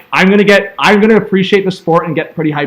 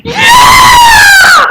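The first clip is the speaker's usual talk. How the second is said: screaming high and loud as the tying goal goes in; ecstatic goal yell; 8 dB louder than usual. Real, not acted. Fan roaring at the screen for the tying goal, ecstatic yell. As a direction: let it rip, full-throated goal call.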